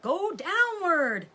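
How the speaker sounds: high, loud, slow command; order. A narrator's reading. Father fox orders his family to dig down, loud command. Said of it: "ordered Mister Fox".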